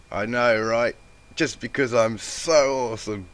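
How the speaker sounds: loud, high boastful brag; brag, full of yourself; egotistical. A narrator's reading. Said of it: "she said egotistically".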